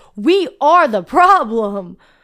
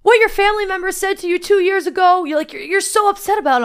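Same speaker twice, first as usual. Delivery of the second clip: high, raised-voice outburst, short of screaming; retold scream; 7 semitones higher, 3 dB louder, faster than usual. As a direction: blurt it out as a mock scream. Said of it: "I just scream out".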